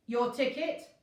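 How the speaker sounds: loud rude snap. A narrator's reading. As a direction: snap it at her impatiently. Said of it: "snapped the mortal rudely".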